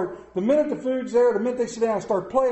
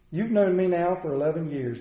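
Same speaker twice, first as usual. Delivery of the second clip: ordinary talk, nothing marked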